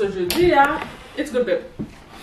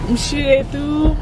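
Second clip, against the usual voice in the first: mocking sing-song; 5 semitones higher, 4 dB louder than usual. Mocking echo, slow and higher.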